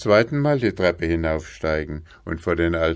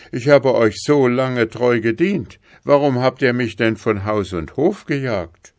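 higher, raised voice of complaint; aggrieved bailiff; 6 semitones higher, 5 dB louder than usual. Acted. Dismissed bailiff pleading his case to the king, aggrieved. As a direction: protest to your sovereign.

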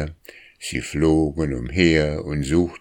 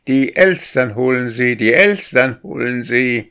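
higher, louder teasing call, stress on Elstern; calling out through cupped hands; 6 semitones higher, 6 dB louder than usual. A narrator's reading. Boy taunts the starling from above, calling through cupped hands. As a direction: shout Elstern across the yard at the bird. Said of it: "hielt die Hände wie ein Rohr vor den Mund und rief".

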